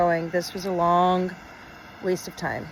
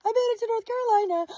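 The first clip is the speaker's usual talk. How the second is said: high, quick giddy squeal; squealing, fast; 13 semitones higher, much faster than usual. Real, not acted. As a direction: squeal it out, overjoyed. State line celebration.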